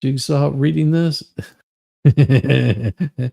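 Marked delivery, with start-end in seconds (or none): 1.7-3.2 laughing. Real, not acted. Laughs at chat's Jigsaw joke.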